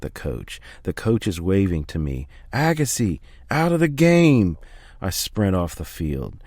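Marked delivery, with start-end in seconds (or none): 2.5-4.6 sharp shout to pull a player off; calling out. Acted. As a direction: coach bellowing across the field. Coach calls player off field.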